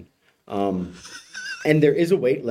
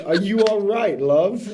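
higher, mock British accent imitating the crow; a Brit crow asking if you are all right; 8 semitones higher, slower than usual. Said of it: "a crow is speaking in a British accent".

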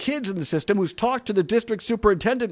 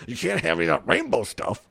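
mocking the superintendent; 3 semitones lower, faster than usual. Mocking superintendent, far timbre.

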